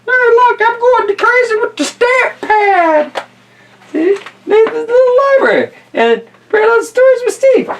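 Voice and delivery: put-on very high, loud giddy kid; little kid voice. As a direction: squeal like a kid gone wild with stamps. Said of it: "looks like some little kid stamped".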